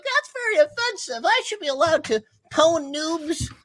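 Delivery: put-on high whiny kid voice; squeaky offended kid; whiny kid gamer.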